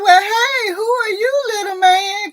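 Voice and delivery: put-on high, loud sing-song baby talk; greeting a child. Woman meeting boyfriend's second kid, imitated high cooing. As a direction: imitate gushing hello to a little one.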